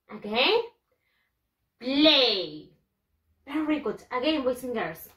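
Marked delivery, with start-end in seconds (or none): 1.8-2.7 "Play" drawn out loudly as "pley"; model pronunciation. Shown as a demonstration. Teacher having pupils repeat again, "Play" modelled as "pley". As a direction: say "Play" for learners to echo, rhyming with "day". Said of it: "Repeat after me".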